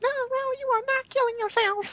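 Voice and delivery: very high hammed-up panic; frantic girly voice. Mail stops Roll from killing herself, very high and hammy. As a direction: shriek it way up like a cartoon girl. Voicing Mail's frantic reply.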